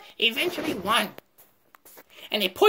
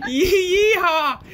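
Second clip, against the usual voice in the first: laughing yeehaw; 3 semitones higher, 5 dB louder, much slower than usual. Acted.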